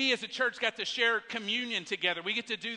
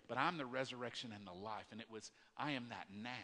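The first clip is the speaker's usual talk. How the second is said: much softer, lower, almost breathed; hushed tender words; 9 semitones lower, 13 dB quieter, faster than usual. Acted. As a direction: speak it gently like comfort at a graveside. Pastor voicing Jesus after grief.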